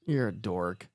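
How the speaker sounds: husband voicing his wife teasing him; lightly voiced wife. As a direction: imitate the wife's deadpan verdict. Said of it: "my wife's inside and shaking her head going".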